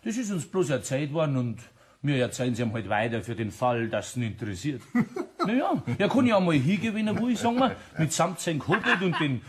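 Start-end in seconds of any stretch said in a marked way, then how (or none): 5.1-9.4 high, giggling delivery, slowing on the joke; can barely get the words out for laughing; tickled by his own idea, laughing throughout; laughing while talking. Acted. Spinning the prank story.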